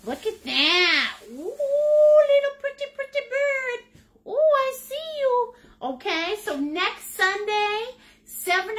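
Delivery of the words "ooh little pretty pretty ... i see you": cooing at bird. Acted. Painter showing off her cardinal to viewers, high cooing baby talk. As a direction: coo up high at the little bird like a pet.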